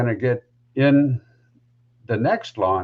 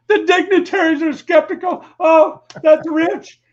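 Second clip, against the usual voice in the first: mocking laugh; 6 dB louder than usual. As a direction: imitate the boss cracking up, much higher. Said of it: "falling all over himself laughing".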